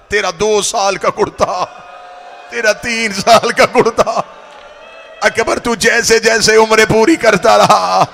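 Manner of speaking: rising into a loud, slow crying wail; building lament. Acted. Preacher voicing Laila over Akbar's kurtas, building wail. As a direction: let grief swell over each year.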